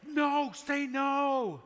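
drawn-out loud yell at the screen. Retold or imitated. Pastor yelling at a TV proposal, slow loud. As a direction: stretch it out, shouting at the TV. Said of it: "but I was yelling".

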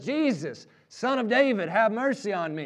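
acted-out beggar's cry for mercy; yelling out. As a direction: call out persistently, ignoring the hecklers. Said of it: "he yells out".

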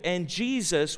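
ordinary delivery

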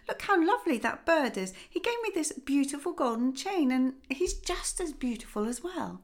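the father beaming with delight; lightly voiced joyful father.

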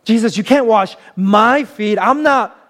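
self-conscious Peter. Acted. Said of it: "acting out here, self-consciousness".

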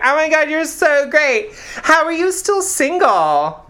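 high, gushing mock friend; hammed-up high gushing voice; gushing wedding guest. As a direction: coo it like a gushing bridesmaid. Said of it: "they were just like".

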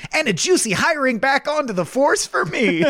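rising gloat; greedy cop. Retold or imitated. Cop gloating over arresting Bender, pitched high. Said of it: "when the cops are arresting".

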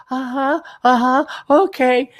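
high-pitched imitation of eager girls; won-over girls. Girls agreeing with the dating coach, mock eager. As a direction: mimic girls lapping up every word. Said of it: "And all the girls like".

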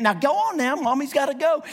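a hint of doting dog mommy; lady coaxing dog. Lady coaxing her dog again.

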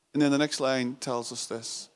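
usual speaking voice

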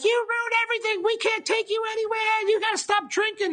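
put-on very high shrill old woman voice; old lady shrieking. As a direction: shriek it like an angry grandmother. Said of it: "she's like".

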